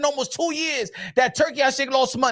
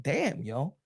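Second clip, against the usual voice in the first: mock brooding Turki; 9 semitones lower than usual. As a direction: mutter it like a wounded rich man. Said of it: "Turkey Out Shake is like".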